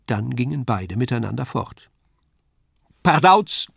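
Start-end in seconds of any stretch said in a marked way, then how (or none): 3.0-3.7 sudden bright call, higher and louder. A narrator's reading. Puppet bursting onto the stage, called out high and loud. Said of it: "rief es".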